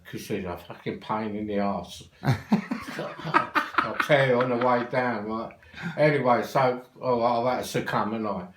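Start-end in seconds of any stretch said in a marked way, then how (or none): 2.1-3.7 a short airy chuckle; breathy laugh. Real, not acted. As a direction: laugh it off, amused. Laughing about annoying aunt.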